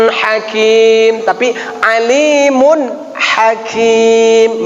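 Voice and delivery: alimun hakim drawn out slowly, Quranic recitation; teacher models "alimun hakim" with long held vowels; correct tajwid reading. Shown as a demonstration. Teacher corrects a student's recitation, slow careful chant. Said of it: "bukan alimun".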